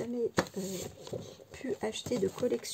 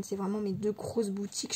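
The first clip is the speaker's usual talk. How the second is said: ordinary talk, nothing marked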